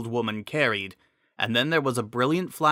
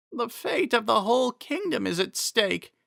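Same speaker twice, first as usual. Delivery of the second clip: higher voice, anxious and concerned; an old protector fussing over her lady; worried Impa; 11 semitones higher than usual. Acted. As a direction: Impa asking the fallen Miranda if she's hurt, worried.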